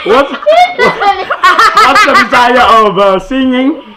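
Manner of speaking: high, slow, broken by real laughing; helpless laughing question; speaking through laughter. Real, not acted. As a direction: struggle to get the question out while cracking up. Teacher resumes through laughing fit.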